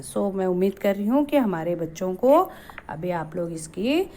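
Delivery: ordinary delivery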